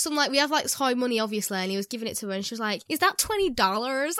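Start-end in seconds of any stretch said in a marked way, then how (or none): 2.9-4.2 slow, put-on American drawl; mocking American accent. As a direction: tease him in a mock American accent. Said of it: "talking back at him in an American accent".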